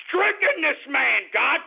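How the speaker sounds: high, fervent preacher's cry; mock preacher cry. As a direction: call it out like a prophet. Repeat, much higher.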